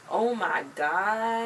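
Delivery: very drawn-out exclamation; dramatic disbelief. Real, not acted. Reacting to the deleted files story, drawn-out drama. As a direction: stretch it way out for drama.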